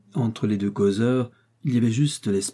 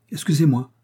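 quick, raised, breathless sorry; hurried apology; 5 semitones higher, 5 dB louder than usual. A narrator's reading. Young man in a hurry blurting his apology. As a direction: blurt it out while rushing past.

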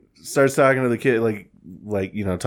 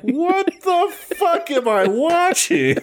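much higher, slow baffled exclamation; stunned disbelief; much slower than usual. Retold or imitated. Retelling his reaction to the video, stunned. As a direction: drag it out in disbelief. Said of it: "I was like".

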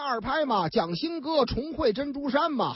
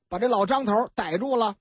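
usual speaking voice

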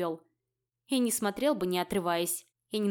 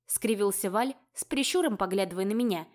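usual speaking voice

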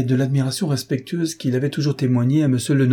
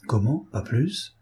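usual speaking voice

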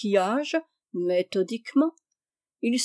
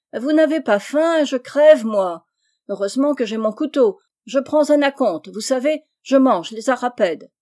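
high, loud voice from the cliff; called from above; 4 semitones higher, 8 dB louder than usual. A narrator's reading. Chabre shouts down to the cave while eating, loud and high. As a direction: call down cheerfully from far above. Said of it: "la voix ... légère, aérienne".

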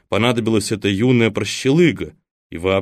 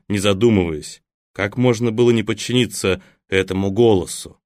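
ordinary delivery, nothing marked

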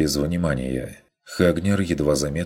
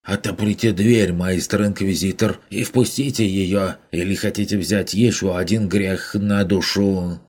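higher, louder indignant merchant; indignant demand; 4 semitones higher, 3 dB louder than usual. Acted. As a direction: press the inquisitor hard. Merchant demands door be opened. (возмущённый купец, громче и выше)

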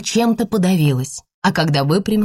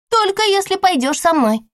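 put-on high sly little girl; bargaining child; 14 semitones higher than usual. A narrator's reading. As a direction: tempt with a lollipop. (хитрый детский торг)

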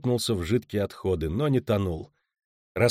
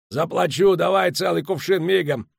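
higher, loud and pushy; boastful order; 7 semitones higher, 5 dB louder than usual. Acted. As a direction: throw money around. Muus orders more.

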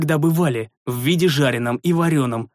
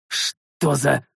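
angry exhale. A narrator's reading. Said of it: "зло выдохнул я".

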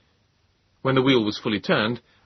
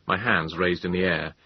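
ordinary delivery

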